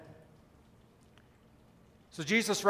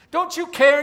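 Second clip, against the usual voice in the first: higher, louder pleading cry; anguished plea; 7 semitones higher, 7 dB louder than usual. Acted. Grieving believer challenging Jesus over a death, anguished.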